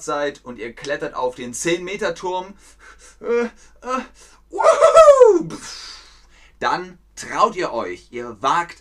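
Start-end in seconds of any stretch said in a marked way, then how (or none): 3.2-5.5 hesitant, then a high joyful whoop; hesitate, then jump and cheer; nervous then whooping. Pretend. Pupil on the ten-meter diving tower, hesitant then whooping.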